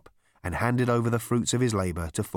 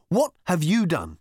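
slow, emphatic interrogation, pitched up; rounding on him; 7 semitones higher, 3 dB louder, slower than usual. A narrator's reading. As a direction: press each question home. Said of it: "rounding on him".

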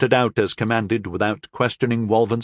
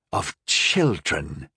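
snort; 7 semitones lower, 4 dB quieter, much slower than usual. A narrator's reading. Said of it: "snorted Rax".

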